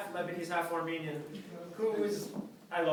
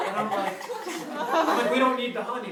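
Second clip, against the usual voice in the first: panicked plea; 6 semitones higher, 7 dB louder than usual. Retold or imitated.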